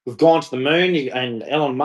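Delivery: normal talking voice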